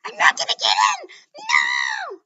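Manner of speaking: loud, high, fast and breathy panicked cry; frantic yell, high and loud. Acted. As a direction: shriek it as the hope slips away. Panicking hero fails exam.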